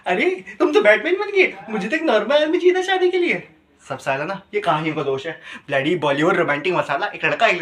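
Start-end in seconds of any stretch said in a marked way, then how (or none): 0.1-3.5 high-pitched mock female complaint; exaggerated girly protest; complaining woman. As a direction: imitate a dissatisfied partner, pitched way up. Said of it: "उसे पता क्या कहने लगी".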